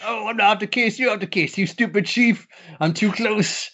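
put-on high, slurred drunk voice; getting drunker. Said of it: "getting drunker and drunker".

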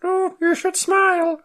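very high, slow cutesy voice; cute bird voice. Pretend. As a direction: coo like a tiny chick. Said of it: "cute little bird voiced".

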